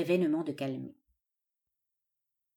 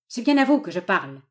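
menacing; 8 dB louder than usual. A narrator's reading. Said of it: "avec un geste de menace".

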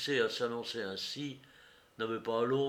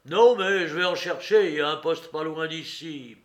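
loud, higher retort; angry, threatening man; 4 semitones higher, 10 dB louder than usual. A narrator's reading. Courrapier will fetch police nearby, threatening.

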